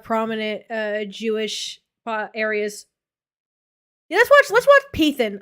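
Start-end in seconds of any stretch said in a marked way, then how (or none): 4.1-5.4 excited. Real, not acted. Eager call to watch video.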